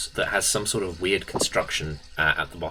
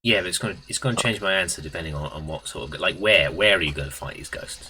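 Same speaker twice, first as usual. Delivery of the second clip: ordinary talk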